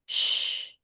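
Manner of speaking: soft, unvoiced "Shh"; "Shh" as a steady airy whistle through separated teeth; hushing S H. Shown as a demonstration. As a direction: hush someone with "Shh". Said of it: "like telling someone to be quiet".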